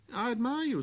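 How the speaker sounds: put-on a small boy musing to himself; little prince. Prince voiced.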